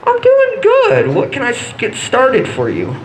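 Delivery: high, slow mock cashier. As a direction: squeak the reply like an overly sweet cashier. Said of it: "from her point of view".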